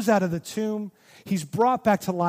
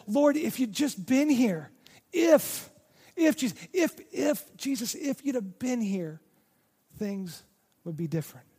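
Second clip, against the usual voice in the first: hurt pleading; 4 semitones higher than usual. Retold or imitated. Hurt Mary to Jesus on arriving late, pleading. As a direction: stammer out the "if", then sink into resignation. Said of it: "Mary feels betrayed by Jesus".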